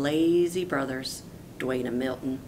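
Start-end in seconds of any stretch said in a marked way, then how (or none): none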